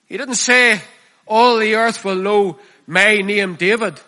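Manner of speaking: the preacher rejecting self-glory, a forceful shout; preaching at full force. Real, not acted. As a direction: drive it home to the congregation. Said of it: "preaching climax".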